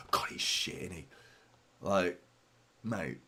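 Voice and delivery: softly imitated disgruntled fan; grumbling City fan. Streamer quoting fans who mean it, muted grumble. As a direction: imitate a moaning fan under your breath. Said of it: "fans out there that speak like that".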